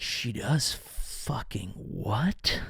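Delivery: slow, soft, awestruck question; stunned disbelief. Retold or imitated. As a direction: drag it out in shocked wonder. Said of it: "you're like".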